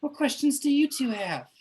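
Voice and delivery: high, sweet little ghost voice; a shy baby ghost eager to help; tiny baby ghost. Acted. GM voices a tiny friendly ghost for the players, high and sweet. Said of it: "tiny itty bitty baby ghost that goes".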